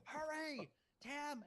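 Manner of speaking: high, loud urgent holler; retold scream. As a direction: yell to hurry someone from afar. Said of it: "I'm screaming".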